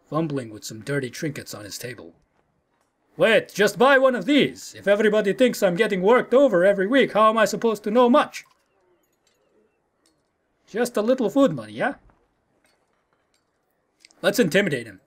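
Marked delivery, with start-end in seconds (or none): 3.2-11.9 bad Russian accent. Had haggling for a little food money, bad Russian accent. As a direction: plead in a badly faked Russian accent. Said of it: "really bad Russian accent".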